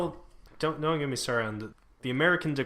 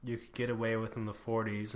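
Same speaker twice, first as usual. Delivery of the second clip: normal talking voice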